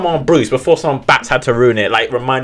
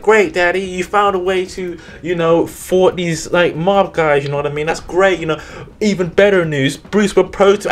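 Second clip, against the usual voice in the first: higher, bright mock-cheerful daughter voice; a daughter gushing with good news, higher; 8 semitones higher than usual. Andrea telling her father the news, higher pitched. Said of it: "Andrea's just like".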